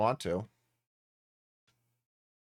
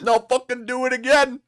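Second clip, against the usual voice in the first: higher, louder cartoon dope; Goofy voice; 10 semitones higher, 4 dB louder than usual. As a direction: bray it like a dopey cartoon dog. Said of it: "I love doing Goofy's voice".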